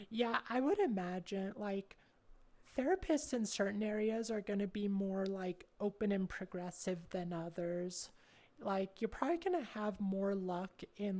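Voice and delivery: ordinary delivery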